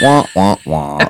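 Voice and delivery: sinking low wah wah wah; sad trombone wah wah. Retold or imitated. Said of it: "Oh, it's Debbie Downer".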